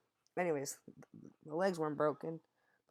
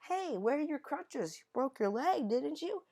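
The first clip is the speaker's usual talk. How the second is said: mocking classmates; 13 semitones higher, 4 dB louder than usual. Classmates quizzed, mock voice.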